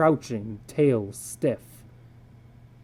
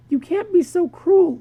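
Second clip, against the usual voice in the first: higher, louder hurt protest; pleading wife; 5 dB louder than usual. Acted. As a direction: beg him not to be cruel.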